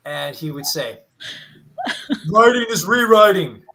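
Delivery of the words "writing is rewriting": loud, high classroom proclamation; teacher yell. Retold or imitated. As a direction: boom it out like a professor to the class. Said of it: "Why am I yelling?".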